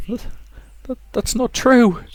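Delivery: put-on high, timid girl's plea; a scared daughter daring to refuse; frightened girl. Rosa telling her mother she won't kill, timid high plea.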